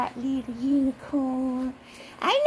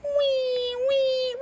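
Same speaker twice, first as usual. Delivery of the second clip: high drawn-out mock wail; baby's pretend cry; 9 semitones higher than usual. Playing the little baby crying, high pretend wail. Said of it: "baby go".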